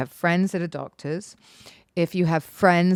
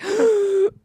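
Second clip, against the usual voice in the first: mock gasp. Shocked friends' reaction.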